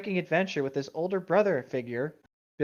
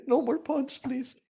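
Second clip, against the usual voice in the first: laughing plea; 8 semitones higher, slower than usual. Real, not acted. Laughing plea after pun.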